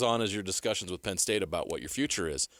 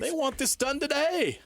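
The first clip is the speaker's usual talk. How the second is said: much higher, slow and insistent; mock impatient demand; slower than usual. Penn State pressing the coach, slow insistent demand. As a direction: spell out the demand slowly.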